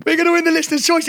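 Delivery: excited squeal. Real, not acted. Host sure they'll win the award, squealing with excitement. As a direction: shriek it like you've already won. Gloating over winning the award.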